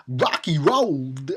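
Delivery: slow, halting Walken cadence on Rocky Road; Christopher Walken impression. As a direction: drag it out like Walken. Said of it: "That was my impression".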